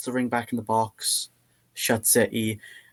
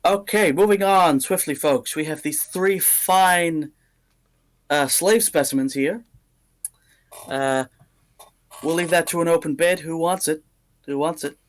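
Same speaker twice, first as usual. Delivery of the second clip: loud, higher auctioneer patter; half-orc auctioneer; 4 semitones higher, 3 dB louder than usual. Acted. The half-orc auctioneer presses the crowd for bids, loudly. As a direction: hurry the crowd along and sell. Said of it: "the half-orc goes".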